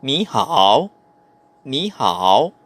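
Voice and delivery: NI rising, then HAO dipping and rising; "ni hao" with rising first syllable, falling-rising second; third-tone change. Shown as a demonstration. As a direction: lift "ni", then dip low on "hao". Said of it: "ipopronounce mo siya as second tone".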